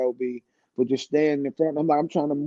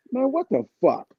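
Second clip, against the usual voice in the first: mock surprised; 9 semitones higher than usual. Greets Tretch years later.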